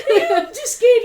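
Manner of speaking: high, loud mock fright through giggles; comic imitation of being scared, shouted high; laughing retelling. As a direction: squeal it while laughing. Repeats client's words while laughing.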